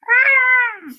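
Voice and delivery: high, loud "ah" roar; playful high-pitched lion's roar. Acted. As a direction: roar out like a storybook lion. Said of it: "The lion roared".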